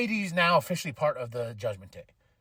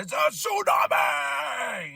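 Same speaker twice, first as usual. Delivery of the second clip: drawn-out high yell imitating an announcer's call; Wade Barrett's commentary shout; 8 semitones higher, 3 dB louder, much slower than usual. Wrestling fan mimics the commentator's signature call, hollering. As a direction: bellow it out, stretched and excited. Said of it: "I love when Wade Barrett".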